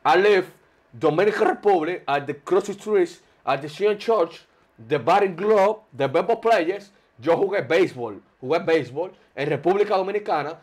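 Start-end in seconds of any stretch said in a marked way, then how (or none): 0.0-6.9 heavy Dominican-accented halting English; broken English. Shown as a demonstration. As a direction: improvise English with a thick Spanish accent. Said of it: "Dígase un par de frases en inglés". (inglés chapurreado con acento dominicano)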